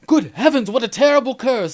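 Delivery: hammed-up, high and loud old-movie gasp; old-movie alarm. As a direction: ham up a 1930s scholar's alarm. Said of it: "this dude read that curse and went".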